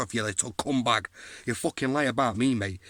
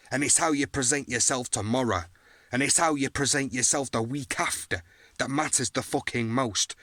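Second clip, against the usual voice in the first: ordinary talk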